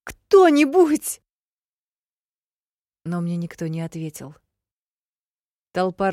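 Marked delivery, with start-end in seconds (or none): 0.1-1.2 desperate cry. A narrator's reading. Heroine begging for help.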